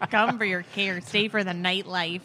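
lightly mock-Minnesotan; a touch of cute Minnesotan; Minnesotan accent. Shown as a demonstration. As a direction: deliver the slogan in a playful Minnesotan accent. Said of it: "Minnesota accent".

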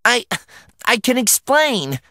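high, halting plea; choked out. A narrator's reading. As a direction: stammer out an urgent protest. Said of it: "I choked out".

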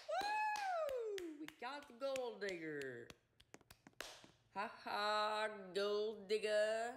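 high, gleeful victory cheer; excited. Real, not acted. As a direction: cheer like you just won. Prank succeeds, he celebrates.

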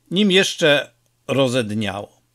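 ordinary delivery

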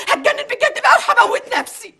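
hysterical threat. Acted.

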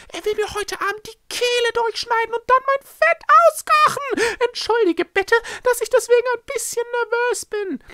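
very high, loud furious screech; temper bursting. A narrator's reading. Echo fears being killed tonight, exploding with anger. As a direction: blow up in a high, frantic creature voice. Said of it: "ihm doch noch der Kragen geplatzt".

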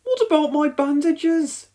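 high, loud plaintive voice; plaintive protest. A narrator's reading. Elvis, still bandaged, asks his officer, plaintive protest.

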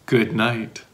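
ordinary delivery

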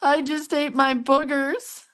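high, drawn-out imitation of a giggly child; playful high-pitched small-kid voice; laughing little grandson. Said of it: "he's laughing. He goes".

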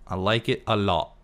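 ordinary talk

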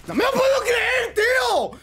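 furious high-pitched shout; raging gamer. Real, not acted. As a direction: scream in disbelief at the death. Raging after being killed.

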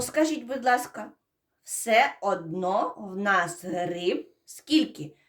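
usual speaking voice

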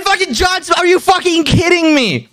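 high-pitched retold protest; incredulous. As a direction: protest in disbelief, voice way up. Retells own protest to friend.

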